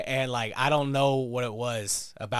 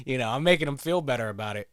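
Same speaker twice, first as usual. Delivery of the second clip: ordinary delivery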